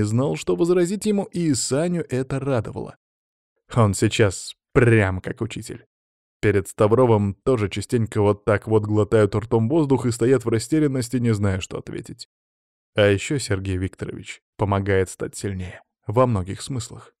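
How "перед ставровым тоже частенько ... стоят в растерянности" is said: usual speaking voice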